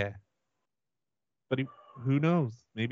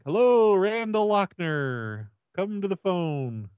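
demonstrated yell-out; 6 semitones higher, slower than usual. Shown as a demonstration. Caller insists worker has to yell out, demonstrated yell-out. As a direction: sing out a mock page, stretching each name. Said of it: "You gotta yell out".

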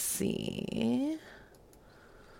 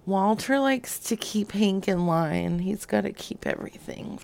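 normal talking voice

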